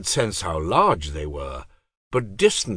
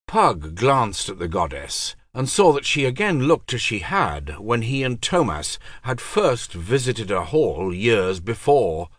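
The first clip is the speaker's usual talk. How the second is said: ordinary delivery, nothing marked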